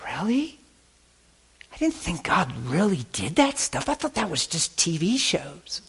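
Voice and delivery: high, wondering voice of a surprised woman; mock-astonished young lady; amazed disbelief. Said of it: "she was like".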